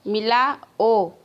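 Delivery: very slow "मिला", break, then "ओ"; split with a pause. Shown as a demonstration. Teacher showing where to pause, slowly splitting "मिलाओ". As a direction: stretch "मिलाओ" and halt before the final "ओ". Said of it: "कहाँ थोड़ा".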